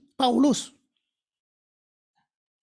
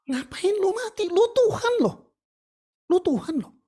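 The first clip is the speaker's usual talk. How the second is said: much higher and louder, disbelieving challenge; incredulous outcry; 9 semitones higher, 4 dB louder than usual. Pretend. Preacher to the congregation, voicing a doubter's cry to Jesus, high loud incredulous. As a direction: throw the question up in loud disbelief.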